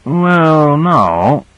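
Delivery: drawn-out, hesitant "well", falling away on "no"; doubtful. A narrator's reading. Michaels answering whether others should help Grant, doubtful. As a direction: hedge reluctantly, stretching the first word high. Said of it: "began Michaels doubtfully".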